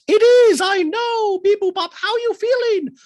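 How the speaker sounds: very high squeaky voice with beep boop robot noises; high mock robot Dorit. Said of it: "Dorit goes".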